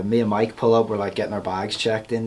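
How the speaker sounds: ordinary delivery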